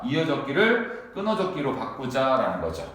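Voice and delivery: ordinary delivery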